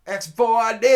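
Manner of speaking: booming, high-pitched yell from afar; shouting big dude. Retold or imitated. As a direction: bellow it like a big street guy. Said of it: "the big dude starts shouting at me".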